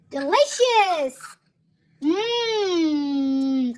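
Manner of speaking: ordinary delivery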